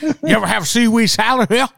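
high, hammed-up Southern voice; mock Southern accent. Host as Yakuza offering food, mock Southern accent. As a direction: ask it in a broad comic Southern accent. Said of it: "that's the accent they get".

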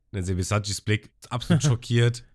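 normal talking voice

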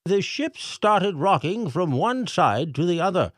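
high, pompous mayor voice reading aloud; mayor reading letter. A narrator's reading. Mayor reads the pelican's message to the crowd, pompous. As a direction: read the message like a fussy mayor.

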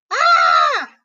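loud, piercing acted scream; high-pitched yell. A narrator's reading. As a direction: shriek high in sudden fright. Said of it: "yelled Bernard".

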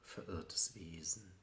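hushed poetic whisper. Acted. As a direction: whisper it as a lost soul. Poem line whispered, later spoken loud.

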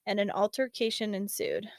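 normal talking voice

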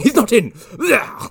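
high, loud lisping interruption; manic butler outburst. Retold or imitated. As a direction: cut in as a frantic mock butler. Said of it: "this cocaine fiend of a butler going".

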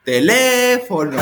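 "Teléfono" with LÉ high and held very long; exaggerated stressed syllable. Imitating thirty children in class, slow, high, hugely exaggerated LÉ in "Teléfono". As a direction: slowly stretch LÉ in "Teléfono" like chanting schoolkids. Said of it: "exagerando muchísimo la sílaba".

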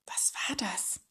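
breathless, whispered fright; frightened whisper; gasping. Acted. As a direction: gasp it out with a pounding heart. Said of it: "keuchte Tüftler".

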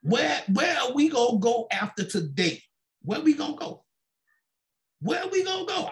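high, rising desperate questioning; retold desperation. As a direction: plead for an answer, pitch climbing with each repeat. Voicing his desperation about housing.

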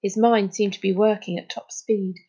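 usual speaking voice

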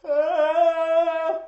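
very high, loud held shriek; shocked scream. Real, not acted. Hearing a friend never saw the favorite movie, shocked shriek. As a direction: shriek in outraged disbelief.

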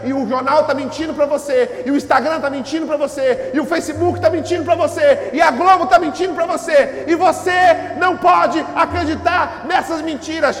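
loud, climbing preacher's cry; full-force preaching. Acted. Pastor to congregation, escalating loud preaching.

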